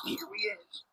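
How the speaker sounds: playful whispered echo. Pretend. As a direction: breathe it out as a whisper. Whispered repeat of line.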